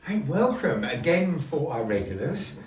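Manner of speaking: usual speaking voice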